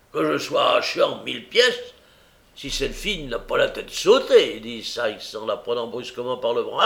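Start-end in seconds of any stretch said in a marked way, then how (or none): 0.1-4.6 loud, forceful swearing; angry oath. Acted. Brigand swears as he grabs her arm, angry. Sykes swears, seizing Nancy.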